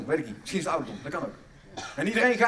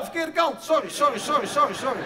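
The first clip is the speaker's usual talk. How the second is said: hammed-up panic; 8 semitones higher, 10 dB louder than usual. Comic delivery-room chaos, shouting, laughing.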